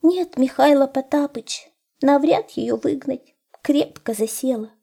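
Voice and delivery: high, plaintive hare voice; tearful hare. A narrator's reading. Hare telling the wolf his sad story, high and plaintive. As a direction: lament in a high little voice.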